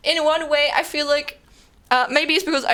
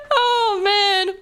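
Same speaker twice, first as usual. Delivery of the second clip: laughing sigh; 10 semitones higher than usual. Real, not acted. After laughing fit.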